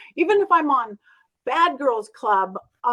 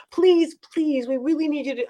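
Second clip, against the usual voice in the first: pleading; 3 semitones higher than usual. Retold or imitated. Friend begging her to do a seance, pleading.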